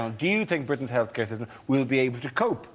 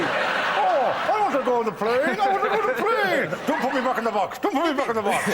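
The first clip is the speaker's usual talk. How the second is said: high squeaky dummy voice, pleading; ventriloquist doll voice; 13 semitones higher, 3 dB louder than usual. Pretend. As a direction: whine like a puppet begging. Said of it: "like a ventriloquist doll".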